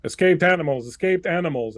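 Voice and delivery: loud repeated alarm announcement; robot alarm call. Acted. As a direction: blare it like a machine alarm. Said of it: "it goes".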